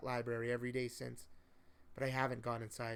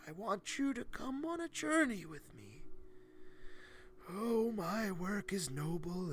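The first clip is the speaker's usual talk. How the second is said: put-on higher, slow weary old man; a tired old mentor coaxing the boy; 7 semitones higher, slower than usual. A narrator's reading. Old man inviting the boy on a journey, higher and tired. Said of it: "he said tiredly".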